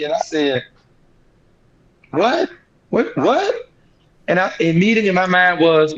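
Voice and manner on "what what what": rising, stunned "What? What? What?", each one higher; shocked disbelief. Retold or imitated. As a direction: disbelief climbing into a cry. Retelling shock at the news.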